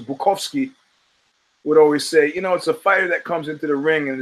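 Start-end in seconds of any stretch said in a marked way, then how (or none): 0.0-0.7 Bukowski with a V, boo-KOFF-skee; wife's pronunciation. Retold or imitated. Podcaster copying his wife's way, Bukowski as boo-KOV-skee. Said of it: "or as my wife says".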